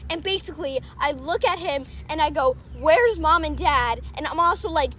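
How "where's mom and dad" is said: louder, slow wail imitating loud crying; retold loud crying. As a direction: call out as if crying, half acted. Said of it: "I'm, like, loud crying".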